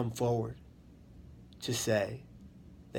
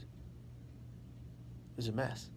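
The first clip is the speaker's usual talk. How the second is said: whispered; 11 dB quieter, much slower than usual. Real, not acted. Remembering sinking.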